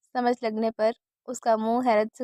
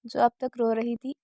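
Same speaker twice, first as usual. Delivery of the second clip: ordinary delivery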